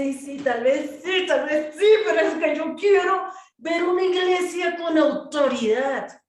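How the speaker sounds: loud, high insistent protest; retold reply building to a loud declaration; impassioned retort. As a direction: push back hard, rising in pitch and volume. Retells own impassioned reply.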